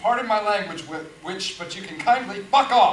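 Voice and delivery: loud, cutting insult with mock politeness; angry retort. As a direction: fire back at the witch. [name] snaps back in quarrel.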